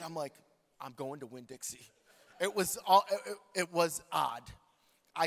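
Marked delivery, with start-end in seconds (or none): 0.8-1.9 low, soft, matter-of-fact repeat; soft low Winn-Dixie reply; quiet. Retold or imitated. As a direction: drop it down, calmly stubborn. Repeating it to his wife.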